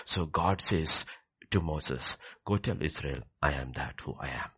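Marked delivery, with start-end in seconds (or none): none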